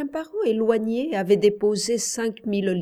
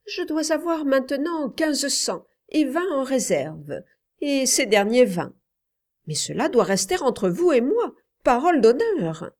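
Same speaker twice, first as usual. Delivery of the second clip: put-on higher-pitched boy's voice, insistent; earnest boy Paul, confiding; 7 semitones higher, 3 dB louder than usual. Boy confiding his secret savings to his uncle, earnest. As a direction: confide it as an eager child, pitched up.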